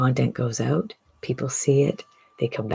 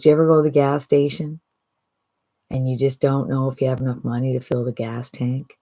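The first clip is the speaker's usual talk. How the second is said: ordinary talk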